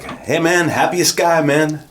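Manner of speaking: high-pitched, cheery retold driver; excited Uber driver. Uber driver greets his passenger, high and cheerful imitation. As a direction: mimic an upbeat driver, pitched well up.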